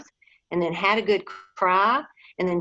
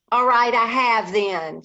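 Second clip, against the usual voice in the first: loud, slow, defiant admission; much louder voice; 5 semitones higher, 6 dB louder than usual. Acted. Diggory admits to Polly he cried, loud and slow. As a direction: throw it out so anyone can hear. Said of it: "said Diggory in a much louder voice".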